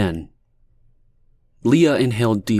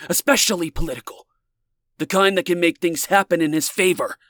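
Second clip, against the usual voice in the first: warning; 9 semitones higher than usual. A narrator's reading. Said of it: "Ben's voice faltered".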